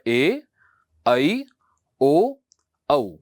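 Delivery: each vowel rising and drawn out; teacher spelling out the vowels; slow, clear recital of ए ऐ ओ औ; reciting vowels. Shown as a demonstration. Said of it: "जो चार अक्षर और हमारे पास स्वर के आते हैं".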